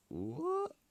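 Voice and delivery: squeaky high stunned "What?"; shocked what. Acted. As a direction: gasp it out in disbelief. Whole school shocked by reveal.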